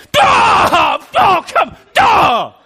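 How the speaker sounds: very high, spluttering outburst of anger; mock uncontrolled rage. Preacher acting out uncontrolled human wrath, exaggerated high shout. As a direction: explode in hammed-up rage. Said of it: "that word wrath or anger, we are like".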